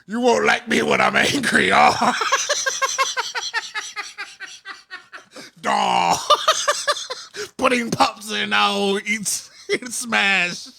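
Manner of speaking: a hammed-up Cosby-Hulk yell; Bill Cosby as the Hulk. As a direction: shout like Cosby playing the Hulk. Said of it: "impression of Bill Cosby as the Incredible Hulk".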